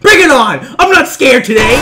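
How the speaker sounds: loud, high bravado; defiant shout. Real, not acted. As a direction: shout a dare at the attacker. Challenging the killer in game.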